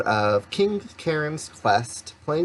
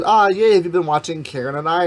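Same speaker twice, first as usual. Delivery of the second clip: excited; 6 semitones higher, 6 dB louder than usual. Retold or imitated. Retelling her own text to dad.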